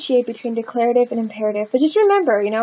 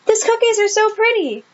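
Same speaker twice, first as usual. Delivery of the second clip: high, bright-eyed delight over the cookies; teacher acting thrilled about pretty cookies; excited gush; 10 semitones higher than usual. Shown as a demonstration. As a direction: gush with joy. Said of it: "so happy".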